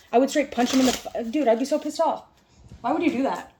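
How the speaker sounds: loud, fired-up indignation; still fuming. Real, not acted. Telling viewers how pissed off she would be, loud and indignant. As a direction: stay heated, high and loud. Still furious about the bottle.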